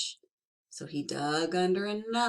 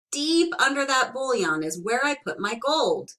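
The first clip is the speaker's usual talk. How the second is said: leprechaun voice; 7 semitones higher, 6 dB louder than usual. Acted. Leprechaun points to gold.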